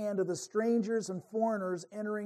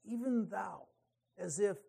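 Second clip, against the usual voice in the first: softened emphasis; 2 semitones lower, 8 dB quieter than usual. Shown as a demonstration. Preacher lingering on Even thou, quietly. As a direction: hush the words Even thou. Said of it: "emphasis on thou".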